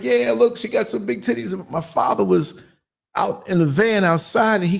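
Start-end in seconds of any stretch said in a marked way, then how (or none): 0.0-1.5 put-on childish joking.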